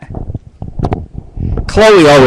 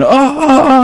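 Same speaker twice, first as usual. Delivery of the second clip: mock moans, much higher; imitated moaning; 11 semitones higher than usual.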